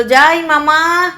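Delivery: high, loud, drawn-out answer; calling back. Acted. Nephew answering uncle from upstairs, calling back loudly. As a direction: sing out a reply from far off.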